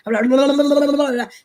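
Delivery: mock gabbled rush of syllables; words tumbling into gibberish; anxious fast babble. Shown as a demonstration. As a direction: imitate yourself racing into a loud, high blur. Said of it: "I speak very fast when I get anxious".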